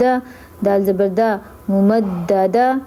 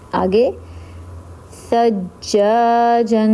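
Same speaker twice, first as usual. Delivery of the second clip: ordinary talk, nothing marked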